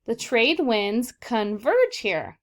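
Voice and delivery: slow, deliberate read-aloud; teacher modeling a sentence, slow and clear. Shown as a demonstration. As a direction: read it out slowly and clearly for the kids. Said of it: "let's read our sentences".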